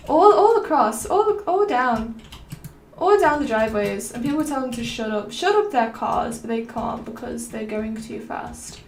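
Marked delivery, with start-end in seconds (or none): none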